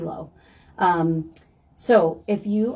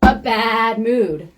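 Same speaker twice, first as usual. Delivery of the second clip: punchline repeat; 3 semitones higher, 7 dB louder, much slower than usual. Acted.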